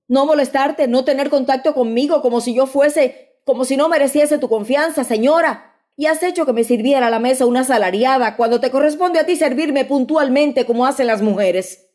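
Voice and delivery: lightly voiced reproach; husband reproaching his wife for keeping distance; resentful husband. A narrator's reading.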